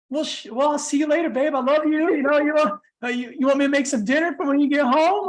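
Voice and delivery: high, sweet mollified husband; sweet and softened. Retold or imitated. Husband to apologizing partner, suddenly sweet and softened. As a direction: melt from anger into sweetness.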